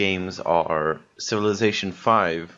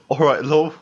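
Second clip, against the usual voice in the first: higher, louder mock Cockney banter; 6 semitones higher, 4 dB louder than usual. Podcaster riffing on London slang in a jokey Cockney accent. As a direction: ham it up like a London market trader.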